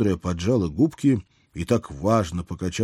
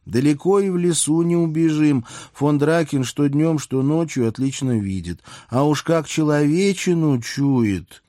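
pondering princess; 7 semitones higher, 3 dB louder than usual. A narrator's reading. Princess rules out escape from the dragon, high-voiced and pondering. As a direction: reason it through in a higher, lingering voice.

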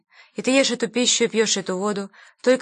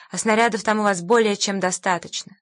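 ordinary delivery

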